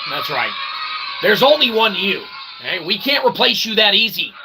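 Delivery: usual speaking voice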